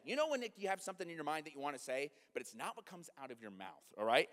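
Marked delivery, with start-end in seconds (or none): none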